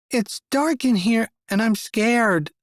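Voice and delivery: put-on high, slow little child voice, frightened; a little girl scared in the dark; frightened child in a dark bathroom stall; small scared voice. A narrator's reading. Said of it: "a small voice said".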